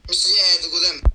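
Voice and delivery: loud yelled question over voice chat; shouting. Real, not acted. Teammate shouting over voice chat, loud. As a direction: bellow it at the teammate. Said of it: "mit kiabáltok?".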